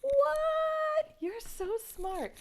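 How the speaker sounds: high, drawn-out mock astonishment; amazed grown-up. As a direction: play an impressed parent, squealing the first word. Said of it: "your grown-up would say".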